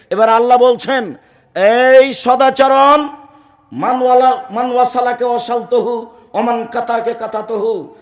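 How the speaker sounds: high, loud hadith proclamation; full-force preaching. Acted. Preaching, quoting God. (পূর্ণ শক্তিতে উচ্চস্বরে ওয়াজ)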